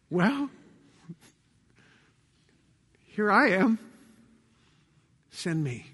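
small, soft, hesitant answer; a little choked up. Acted. Isaiah finally responds to God, soft and a little choked up. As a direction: falter with long pauses, sinking away. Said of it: "He might have choked up a little bit".

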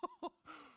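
a short high laugh breaking out. Real, not acted. Preacher laughs at her own joke, a short high laugh.

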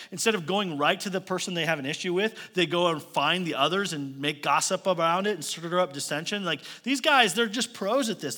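ordinary delivery

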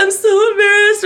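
high-pitched whine; imitating your own crying self; mock tearful embarrassment. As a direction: whimper it, hammed-up. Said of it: "I'm sitting there crying".

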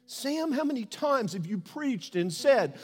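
loud, high rebuke; rebuking. Retold or imitated. God confronting the pastor about hate, loud and high. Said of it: "God began to say".

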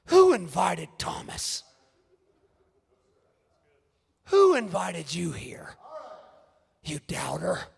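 slow, low sneering question; mock snub. Church people snubbing Thomas, mock sneer. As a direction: play the cold host shutting him out.

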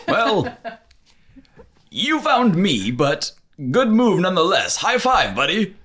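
high, friendly genie voice. Acted. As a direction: cheer him on like a buddy.

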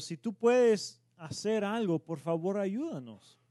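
desperate plea. Retold or imitated. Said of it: "Y dice el padre".

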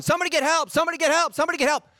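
high, urgent imitation of a crowd yelling; mock yelling. Party guests watching the alligator attack, imitated high-pitched yelling. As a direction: yell it high, repeating like a panicked onlooker. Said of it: "everybody's yelling".

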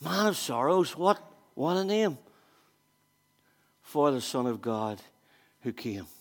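soft, low, mock-worried aside; hushed mock dismay. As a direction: say it under your breath as if dreading the guest. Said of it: "you'd be thinking".

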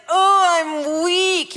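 drawn-out, self-pitying mock moan; exaggerated slow groan of defeat; mock whine. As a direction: whine it out slowly, wallowing. Acts out whining about weakness.

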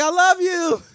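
high, loud mock cry of I love you; the woman's shrill farewell, hammed up; imitating the woman. Retelling the dog video, loud high mock cry. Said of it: "she was like".

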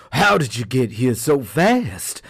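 a shocked mock shout; mock scream. A narrator's reading. Shocked tiger shouts at tortoise, mildly loud. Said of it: "he screamed".

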